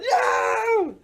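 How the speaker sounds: high, drawn-out scream of fright; yell. A narrator's reading. Morris startled by Boris's boo, high yell. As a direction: shriek as if spooked. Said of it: "Morris yelled".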